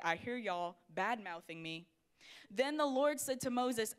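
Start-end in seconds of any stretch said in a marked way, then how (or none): 0.0-1.9 God's voice. Retold or imitated. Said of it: "after God is like".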